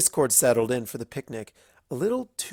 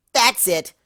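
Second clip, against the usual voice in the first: higher, louder outburst; loud high snap of patience; exasperated exclamation; 10 semitones higher, 9 dB louder than usual. A narrator's reading. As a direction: burst out fed up. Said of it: "Rainbow exclaimed".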